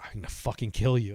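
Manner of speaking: loud mock-furious threat; angry threat. Trapped friend threatening him, loud and angry. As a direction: threaten him through the curtain. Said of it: "he's like".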